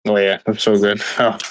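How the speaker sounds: ordinary talk, nothing marked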